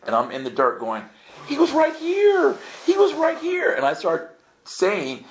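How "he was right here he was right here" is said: high-pitched mock-hysterical cry; freaking out. Pilgrim in the dirt after a revelation, freaking out in a high cry. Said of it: "I'm freaking out".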